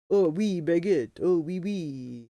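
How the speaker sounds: cartoon French accent, drawn out at the end; hammed-up French accent; mock French accent. Shown as a demonstration. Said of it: "get close to sounding like I speak French".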